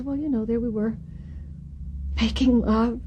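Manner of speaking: soft, halting, tearful confession; tearful widow. Acted. Widow explains her husband's death, softly tearful. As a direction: play the weepy widow quietly, with a pause. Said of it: "and she's crying".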